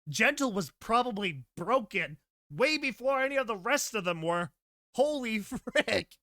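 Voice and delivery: half-shouted through laughter; can't keep it together. Real, not acted. Reader cracking up over the mad mare's story, laughing loudly.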